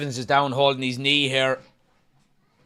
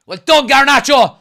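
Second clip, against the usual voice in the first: loud, high joyful yell; excited shout; 9 semitones higher, 13 dB louder than usual. Real, not acted. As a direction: cheer him at full voice. Celebrating a big moment.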